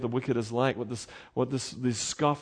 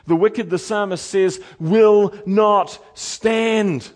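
preaching at full force; 4 semitones higher, 6 dB louder than usual. Acted. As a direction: preach the judgment with swelling force. Preaching the judgment passage.

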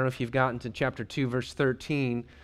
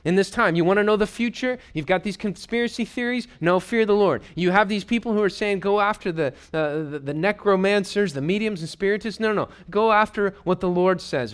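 louder, higher, urgent pleading to the congregation; full-force preaching; 6 semitones higher, 5 dB louder than usual. Acted. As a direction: drive it home like a revival preacher.

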